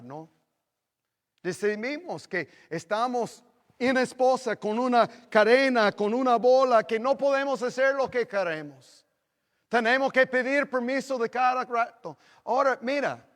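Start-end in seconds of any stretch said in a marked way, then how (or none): 1.4-13.2 loud, high mock-lament preaching; fiery preaching. Pastor joking to church about married life. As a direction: hammer it out, half joking.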